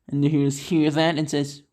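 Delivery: ordinary delivery, nothing marked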